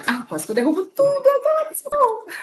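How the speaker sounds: high, startled yelp after nearly dropping things; flustered near-spill, voice jumping high; startled exclamation. Real, not acted. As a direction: jump up in pitch, flustered. Nearly drops everything live.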